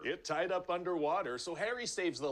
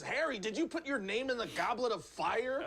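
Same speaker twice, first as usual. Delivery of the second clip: put-on higher, agitated headmaster shaking a boy; angry Dumbledore; 8 semitones higher than usual. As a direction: demand it of Harry like a rattled old wizard. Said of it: "that doesn't really sound like Dumbledore".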